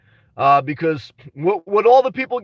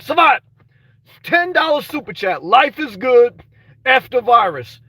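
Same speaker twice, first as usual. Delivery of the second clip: excited shout-out; 5 semitones higher, 4 dB louder, slower than usual. Real, not acted. Reads out a super chat excitedly.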